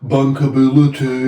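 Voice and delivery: hammed-up Birmingham accent; Brummie accent. Shown as a demonstration. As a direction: stretch the word out in comic Brummie. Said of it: "Can you do a Brummy accent".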